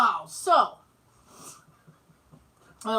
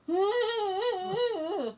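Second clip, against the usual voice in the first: long, high wordless whine; imitated high-pitched panic; mock panic noise; 9 semitones higher than usual. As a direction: hold a high sound of needle dread. Acts out reaction to needle.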